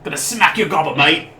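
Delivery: loud Cockney orc threats. Retold or imitated. Cockney orc threatening the player, loud. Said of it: "sounds like a Cockney orc".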